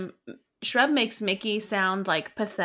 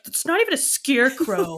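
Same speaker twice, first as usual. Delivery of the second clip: mildly indignant, amused retelling; mock upset; 3 semitones higher than usual. Podcaster retells Shrub's complaint about a rival, mock upset. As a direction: protest it with a laugh underneath. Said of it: "so upset. He's like".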